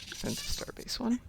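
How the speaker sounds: hushed whisper of mission name. Real, not acted. Repeating the mission name softly.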